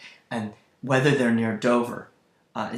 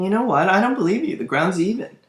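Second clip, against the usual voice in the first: acted disbelief; 7 semitones higher, 6 dB louder, faster than usual. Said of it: "He's saying".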